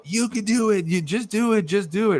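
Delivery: ecstatic. Retold or imitated. Host voices elves urging people on, imitated ecstatic cheering. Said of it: "they're very, um, ecstatic about".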